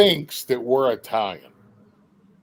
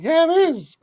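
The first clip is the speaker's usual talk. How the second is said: teasing retort; 5 dB louder than usual. Retold or imitated. Teasing Steve about homeland.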